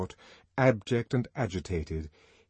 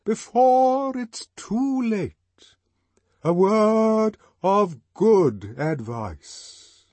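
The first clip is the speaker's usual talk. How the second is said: louder, much higher, very slow solemn; solemn severity; 12 semitones higher, 9 dB louder, much slower than usual. A narrator's reading. Songster rebuking Bernard, loud solemn slow severity. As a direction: pronounce it like a stern sentence. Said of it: "loud and solemn severity".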